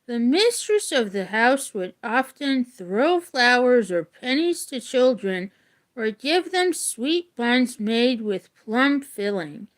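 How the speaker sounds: usual speaking voice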